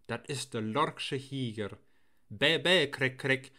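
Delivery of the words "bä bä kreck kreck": mocking bird call. Herd boy answering the screeching jay, mocking imitation. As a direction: throw the bird's cry back at it.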